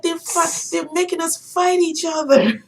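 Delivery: high-pitched worked-up retelling; imitating a rant. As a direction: act out an impassioned complaint. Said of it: "he was going on a rant".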